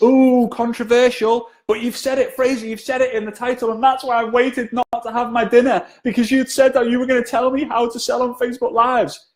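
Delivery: high, playful mock outrage; teasing protest. Real, not acted. Co-host teasing the host about the title, high and playful. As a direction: tease him for the bait title.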